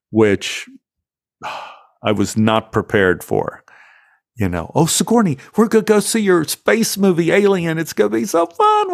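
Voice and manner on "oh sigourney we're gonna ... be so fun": bright, eager and much higher, bubbling over; giddy excitement. Retold or imitated. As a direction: gush like a kid promised a treat. Excited invite to space movie.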